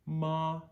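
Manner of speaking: ma held high and level like a sung chorus note; first tone ma. Shown as a demonstration. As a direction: sing ma on one high flat pitch. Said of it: "first tone is the chorus tone".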